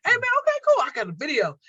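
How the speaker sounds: high-pitched mock hype; mimicking eager guys. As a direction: imitate an over-keen man sliding into messages. Mimicking guys pestering her in messages.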